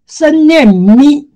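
"生念咪" said slowly, drawing out 咪; slow reading of 咪. Shown as a demonstration.

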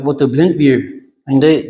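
normal talking voice

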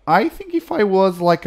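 normal talking voice

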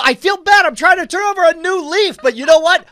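high, loud protest; hammed-up loud declaration of remorse; mock confession. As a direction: blurt it out at full volume. Acts a loud mock confession to team.

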